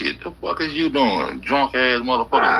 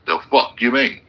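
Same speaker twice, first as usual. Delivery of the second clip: ordinary delivery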